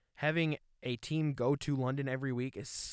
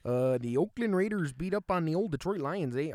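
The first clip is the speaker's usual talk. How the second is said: mock Canadian accent, pitched up and punchy, ending in "eh?"; 3 semitones higher, 3 dB louder than usual. Mock Canadian accent, reading the game score. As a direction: read the score like a chipper Canadian announcer. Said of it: "All right, I'm done with that".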